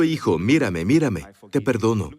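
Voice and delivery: low, gentle father's voice; tender to a son. Shown as a demonstration. Preacher voicing a father forgiving his son, low and tender.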